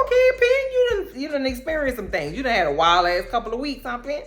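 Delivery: very high, mocking sing-song; high-pitched ribbing of Penelope; teasing falsetto. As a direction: squeal it at her like a gossiping friend. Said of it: "I was like".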